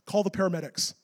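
ordinary delivery

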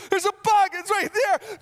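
high, loud frantic shout; panicked daughter. Retold or imitated. Dad imitating his daughter screaming about the bug. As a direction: yell it like a terrified little kid.

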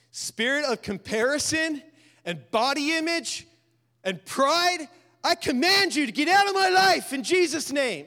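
booming, rising spiritual warfare command; commanding rebuke. Shown as a demonstration. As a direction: cast it out with full force. Rebuking the devil forcefully.

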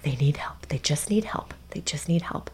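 soft repeated whisper; whispered mantra. Shown as a demonstration. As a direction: whisper it to yourself. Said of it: "I'm like".

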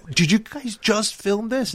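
lightly put-on astonished question; surprised mock question. Viewers asking the band about the snow video, mock surprised.

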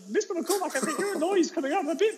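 high, squeaky pupil voice; little kid reporting something odd; imitated schoolboy. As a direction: call the teacher like a small boy. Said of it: "this one boy".

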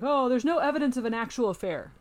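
exaggerated, fast parody of a denial; mocking defense lawyers. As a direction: rattle off the defense's excuses scornfully. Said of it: "And they're like".

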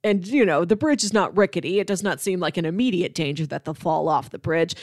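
ordinary talk, nothing marked

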